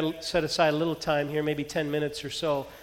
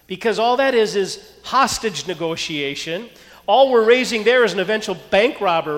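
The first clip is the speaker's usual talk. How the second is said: loud, driving sermon delivery; full-force preaching; 5 semitones higher, 8 dB louder than usual. Real, not acted. Pastor preaching loudly to his congregation about stubborn children. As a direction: preach it out to the whole room.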